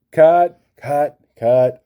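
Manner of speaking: loud frustrated cut; calling cut. Real, not acted. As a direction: stop the take sharply. Stops the take again.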